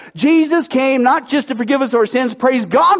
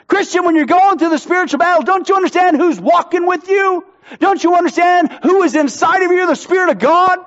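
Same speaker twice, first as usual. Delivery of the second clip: raised, higher preaching push; fiery preaching; 5 semitones higher than usual. Acted. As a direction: drive it home to the congregation. Preaching at full force.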